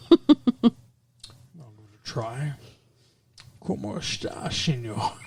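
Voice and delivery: hushed Scottish burr attempting Spanish; soft, slushy Connery failing at a Spaniard; Sean Connery impersonation. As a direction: murmur bad Spanish in a thick Scottish slur. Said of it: "All right, Sean".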